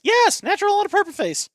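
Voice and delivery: very high, loud excited squeal; mock excitement. Answering a remark on purple skin, high mock excitement. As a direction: exclaim it in a high, hammed-up voice.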